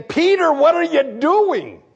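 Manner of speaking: high, slow call across the water; alarmed brother calling out. Acted. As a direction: call out to Peter in disbelief. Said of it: "His brother Andrew was saying".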